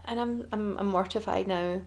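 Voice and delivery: usual speaking voice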